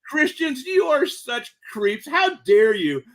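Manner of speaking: high, slow, exasperated tirade; mock outrage at the squabbling churches; indignant scolding. Indignant convert scolds quarreling Christians.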